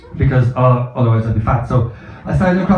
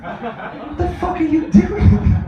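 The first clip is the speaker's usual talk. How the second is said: higher, slow disbelieving rant; exasperated rant; 11 semitones higher, much slower than usual. Real, not acted. Ranting at tourists shopping on holiday, slow and high. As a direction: drag it out in disbelief.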